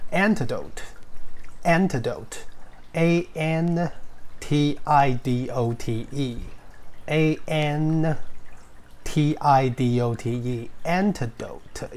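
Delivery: antidote said slowly and spelled letter by letter; slow spelled demo. Shown as a demonstration. Teacher spelling antidote slowly for students. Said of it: "第二个字叫做".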